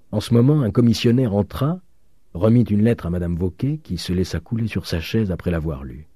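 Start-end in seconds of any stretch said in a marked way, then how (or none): none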